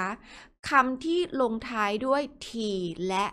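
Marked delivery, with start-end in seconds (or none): none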